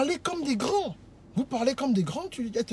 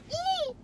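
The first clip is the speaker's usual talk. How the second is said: high, quiet drawn-out hum; long high hum, kept soft; disapproving hum; 8 dB quieter than usual. Retold or imitated. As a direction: imitate a woman's sceptical "hmm". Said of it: "si elle te regarde bien comme ça".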